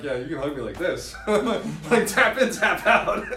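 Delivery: loud, high, laughing mock hug talk; imitating a quick-hug I. Speaker playing an I getting hugged, loud and laughing. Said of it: "they're like".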